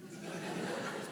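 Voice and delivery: real quiet laugh under the breath; soft airless chuckle. Real, not acted. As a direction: snicker at your own mistake. Joke about forgetting the number.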